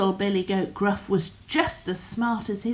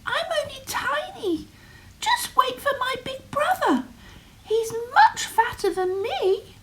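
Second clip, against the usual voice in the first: much higher little goat voice; tiny goat voice. Acted. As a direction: talk small and sweet to the troll. Smallest goat talks to troll.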